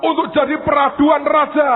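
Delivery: high, loud pulpit cry over music; preaching at full force. Acted. Preacher telling the congregation of the tree's dream, shouting.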